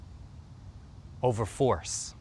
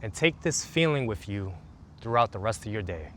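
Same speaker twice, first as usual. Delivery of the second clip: guided meditation; 2 semitones lower, 3 dB quieter than usual. Acted. Coach leading listeners through a calming routine, soothing meditation voice. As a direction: guide them gently into the body.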